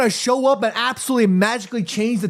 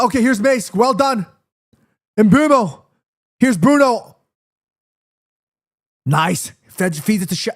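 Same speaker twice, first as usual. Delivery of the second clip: ordinary talk, nothing marked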